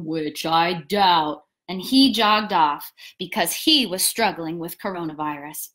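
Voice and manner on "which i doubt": very low, slow glum donkey; Eeyore. A narrator's reading. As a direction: drag it out, deep and glum. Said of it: "said Eeyore".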